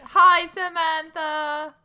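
high, slow, drawn-out hello; cute greeting. Real, not acted. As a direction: greet the little creature sweetly. Player greets creature.

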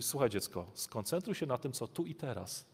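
soft, tender fatherly voice; calm a child down gently; gentle father. Retold or imitated. Good father soothing his child, soft and tender. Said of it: "I dobry ojciec mówi".

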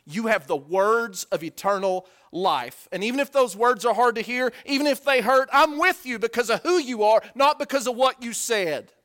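building loud, high confession; fervent preaching. Acted. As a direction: swell into a passionate vow.

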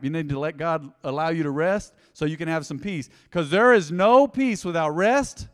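ordinary talk

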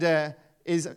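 ordinary talk, nothing marked

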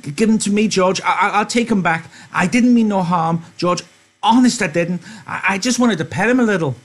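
put-on high stammering childlike plea; pleading Lenny. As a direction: beg like a guilty child. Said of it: "Lenny held out his hands pleasingly".

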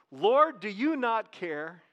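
each word drawn out and weighed; slow emphatic reading. Shown as a demonstration. Preacher slowly repeats Martha's words to the congregation. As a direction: lay it out slowly for them to hear. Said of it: "just look at that part of it".

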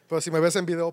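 ordinary talk

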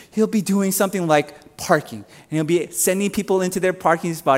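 ordinary delivery

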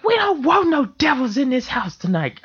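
high-pitched imitation of a girl praying fiercely; mock little-girl voice, fired up in prayer; little girl's angry prayer. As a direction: cry it out like a tiny preacher. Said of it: "the little angry prayer".

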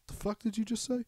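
imitating a guy squaring up; mock outrage from a movie thug; mock threat. Retold movie confrontation quote.

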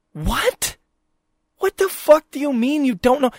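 high incredulous what the fuck; furious at the clueless worker, incredulous; outraged disbelief. Real, not acted. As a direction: pitch it up in disbelief.

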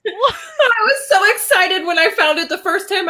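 high, laughing excited words. Real, not acted. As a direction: laugh while talking. Laughing while telling.

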